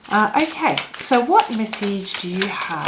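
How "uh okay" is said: ordinary delivery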